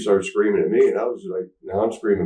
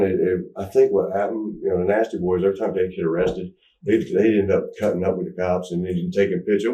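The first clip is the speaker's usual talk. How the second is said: ordinary talk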